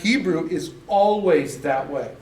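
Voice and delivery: usual speaking voice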